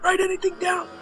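a squeaky take on the mobster from Casino; Casino mobster impression. Doing the guy from Casino, a high mobster impression. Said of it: "the guy from, uh, what was it, uh, Casino".

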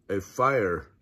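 ordinary delivery

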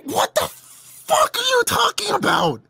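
high, slow, stunned what are you talking about; incredulous outburst. Real, not acted. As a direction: squeal in total disbelief.

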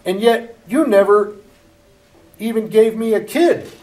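loud, high reproach; resentful son. Acted. Elder son rebuking his father, loud and high. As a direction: throw the grievance at his father.

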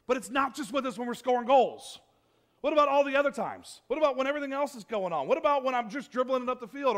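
loud, rising, accusing questions; frustrated athlete complaint. Retold or imitated. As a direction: vent at God like a benched player. Athlete complaining God wasn't there.

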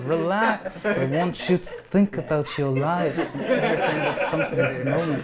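lightly imitating [name], laughing as you go; David [name] voice. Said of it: "sounds more like David [name]".

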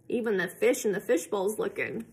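ordinary delivery

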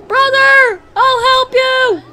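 high, loud, slow hero's cry; hammed-up rescue cry; heroic calling out. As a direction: call out to the brother like a movie hero. Heroically calling to game brother.

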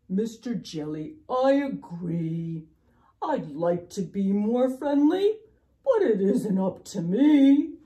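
pout-pout fish pleading helplessness; glum, helpless fish. Acted. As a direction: protest loudly with stretched phrase ends. Voices the fish's reply.

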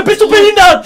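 high, loud indignant yell; real outraged outburst; outraged shout. Real, not acted. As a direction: shout it in disbelief. Outraged at paint in eye.

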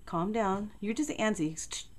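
ordinary talk

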